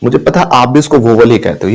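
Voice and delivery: ordinary talk, nothing marked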